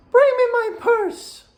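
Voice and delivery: high, slow, bossy stepmother voice. Retold or imitated. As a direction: voice the stepmother ordering him around, pitched high.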